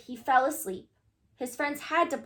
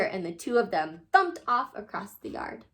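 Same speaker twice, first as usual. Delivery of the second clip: plain reading voice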